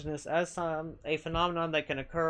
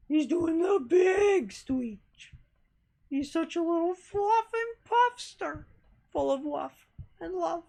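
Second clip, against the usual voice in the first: high cooing pet talk; 11 semitones higher, slower than usual. Real, not acted. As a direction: coo over the fluffy kitty. Talking to her cat.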